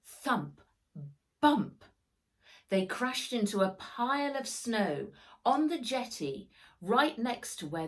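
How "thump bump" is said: ordinary delivery, nothing marked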